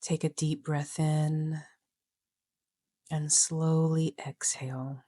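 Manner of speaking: measured, unhurried instructions with long pauses between phrases; guided meditation. Acted. Coach leading listeners into a short meditation, guided meditation voice.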